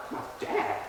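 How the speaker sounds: very soft, near-whispered question; stunned disbelief. Retold or imitated. Teenager answering cop about dad, hushed and stunned. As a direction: act out hushed bewilderment.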